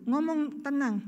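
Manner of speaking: normal talking voice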